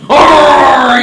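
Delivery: loud, high pirate battle "arr"; pirate roar. Acted. Pirate mate charging the time machine door, pirate roar.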